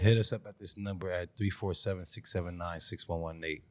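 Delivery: very low, soft recital; mumble-level volume, pitched right down; talking too low. Real, not acted. Co-host gives the voicemail number, too low and quiet. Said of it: "They say you talk too low".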